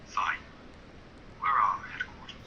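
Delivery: thin, quiet telephone voice; faint phone-filtered villain; over the phone. Acted. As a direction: sound like a voice down the line.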